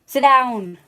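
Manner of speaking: real loud repeated order; yelling at the dog. Real, not acted. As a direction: make the dog obey now. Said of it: "I'm yelling at the dog".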